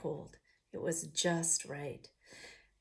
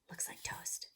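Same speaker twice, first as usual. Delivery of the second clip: soft unvoiced whisper; 12 dB quieter than usual. Real, not acted. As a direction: whisper it like a secret. Whispered aside to viewers.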